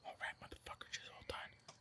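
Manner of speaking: unvoiced sniper whisper. Man hiding from the shooter, whispering to himself. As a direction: breathe it silently while hiding. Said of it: "I'll be like".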